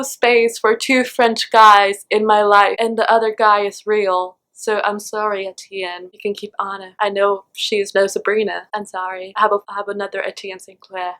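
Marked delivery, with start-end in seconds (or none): 4.5-11.1 quiet, softer apology to Etienne; hushed breakup apology to a character. Real, not acted. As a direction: let him down gently, softly.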